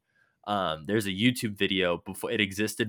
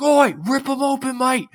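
high, loud, manic Australian cartoon shriek; crazed Australian knife voice, much higher; Australian Rick voice; 9 dB louder than usual. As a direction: squeal it like a bloodthirsty Aussie knife. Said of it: "it's that exact voice".

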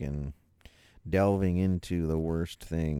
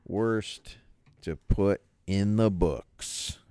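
usual speaking voice

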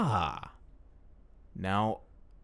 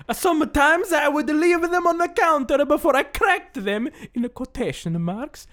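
put-on higher, slow Italian accent, lilting; 10 semitones higher than usual. Reading the ice tray review in an Italian accent. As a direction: keep going as an old Italian man. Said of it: "very impressive Italian accent".

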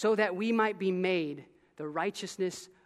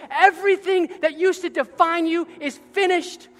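higher, louder fervent preaching; preaching at full force; 5 semitones higher, 8 dB louder than usual. Acted. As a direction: drive the truth home to the church. Preaching climax.

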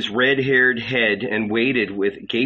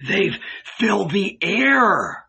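high, drawn-out cry of dread; terrified; 9 semitones higher, slower than usual. A narrator's reading. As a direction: stretch it out, pitched up in terror.

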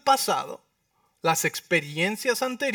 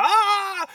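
a sudden high drawn-out "ah!"; mock panicked shout; retold yell; 13 semitones higher, 4 dB louder than usual. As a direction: cry out like frightened fishermen.

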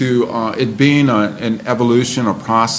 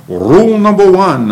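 a light Scottish brogue, high and loud; lightly voiced Sean Connery; 8 semitones higher, 7 dB louder than usual. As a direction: announce it like a Scottish film spy. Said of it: "in my best Sean Connery brogue".

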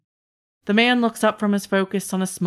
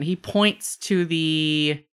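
ordinary delivery

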